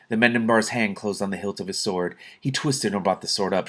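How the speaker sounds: plain reading voice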